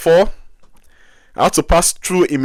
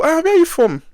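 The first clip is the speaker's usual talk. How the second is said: high, loud hearty greeting of a customs man; loud customs officer; 5 dB louder than usual. Retold or imitated. Customs officer greeting the traveller, high and loud. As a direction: boom out a nosy officer's welcome.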